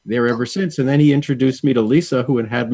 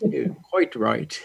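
slow, soft mock British accent; put-on British accent; 3 semitones higher, 5 dB quieter, slower than usual. Agreeing that London viewers watch, slow mock British accent. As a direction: draw it out in a playful London accent. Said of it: "I love Swami's accent".

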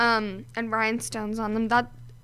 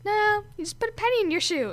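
mom voice; 9 semitones higher than usual. Retold or imitated. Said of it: "And she's like".

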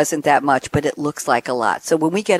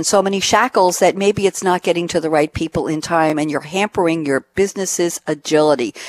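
usual speaking voice

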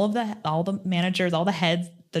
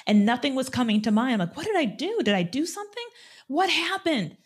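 high, racing anxious questions; frantic worry; 8 semitones higher than usual. Retold or imitated. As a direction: spin in circles of panic. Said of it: "like a hamster on a wheel".

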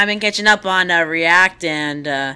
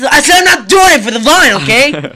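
loud, higher hammed-up yell; mock shout; 6 semitones higher, 7 dB louder than usual. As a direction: bellow the refusal. Vine meme quote, shouted.

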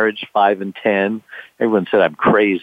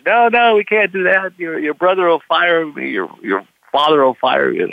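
mock alarmed first mate; 8 semitones higher than usual. First mate begging the skipper not to sail, high alarmed voice. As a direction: plead like a nervous crew member afraid of being fired.

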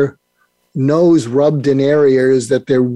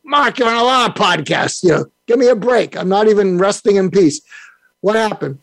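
put-on higher, fed-up Brooklyn accent; Larry King Brooklyn voice; 6 semitones higher than usual. Dead mentor Larry King scolding Mark, in a Brooklyn accent. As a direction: Brooklyn old-timer brushing it off, pitched up. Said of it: "in his Brooklyn voice said".

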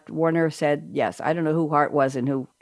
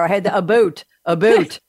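mock Canadian accent; 5 dB louder than usual. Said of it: "am I hearing a Canadian twang in there".

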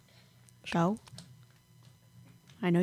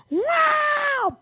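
mock startled yell. Retelling smacking her older sister awake, mock yell. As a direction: burst out like jolted from sleep. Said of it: "and I was like".

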